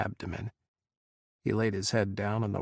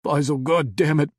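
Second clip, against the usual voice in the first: higher, loud broken cry; anguished shout; 4 semitones higher, 8 dB louder than usual. Acted. Adam crying out at Faisal over the phone, voice breaking. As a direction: curse in despair. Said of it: "his voice falling apart as he gasped".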